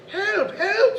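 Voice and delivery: high, loud squeaky cry for help; tiny squeaky yelp for help. A narrator's reading. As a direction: call out in a squeaky little voice. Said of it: "said the squeaky voice".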